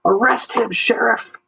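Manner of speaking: high, strained shout. Acted. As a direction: yell the demand at the sheriff. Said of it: "shouted Harvey Bender".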